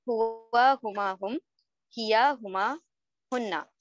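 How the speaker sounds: measured row-by-row drill with lilting rises; right-way table recitation. Shown as a demonstration. Teacher showing students horizontal reading, table recitation. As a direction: recite each row as a group, pausing between rows. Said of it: "इसी तरह से पढ़ना है".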